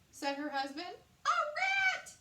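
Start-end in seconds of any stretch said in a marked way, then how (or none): 1.3-2.1 far higher, loud screech. A narrator's reading. Queen screeching at the rat in her soup. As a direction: screech in horror. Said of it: "she screeched".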